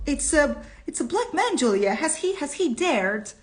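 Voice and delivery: high, halting outraged voice; outraged stammer. Acted. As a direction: sputter it out in shock, pitch high.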